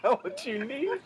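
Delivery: high laughing echo of the line; mock the line while laughing; a friend parrots the story line through giggles; laughing mimic. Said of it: "repeating the story's line".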